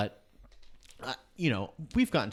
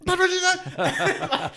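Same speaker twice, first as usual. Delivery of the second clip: much higher, louder, slow, laughing outburst; bursting shout; 9 dB louder, much slower than usual. Retold or imitated. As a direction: blurt it out, finally unable to hold it. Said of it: "he's just like".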